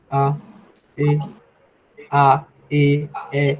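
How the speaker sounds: A and E on a level mid pitch, spaced out; slow, level Douala vowels; tone demo. Shown as a demonstration. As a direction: sound out each vowel on the mid tone. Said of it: "ça se prononce".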